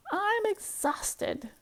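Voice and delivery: slow, high weary sigh. Acted. The tired hen sighs after painting all day. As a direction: drag it out, worn out. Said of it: "sighed the hen".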